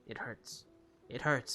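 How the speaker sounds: ordinary talk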